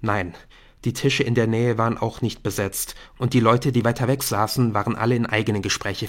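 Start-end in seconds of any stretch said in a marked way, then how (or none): none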